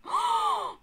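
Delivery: high, airy gasp; sudden startled gasp; shocked gasp. Real, not acted. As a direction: suck in breath sharply, high and loud. Reacting to scene.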